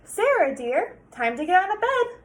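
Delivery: sing-song call to wake her; cheery dad wake-up. Acted.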